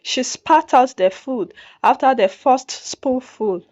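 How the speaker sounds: usual speaking voice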